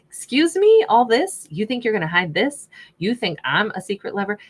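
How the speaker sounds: affronted and incredulous, hammed-up; indignant. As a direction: play the offended queen, pitch leaping at first. Voices queen, offended at being hidden.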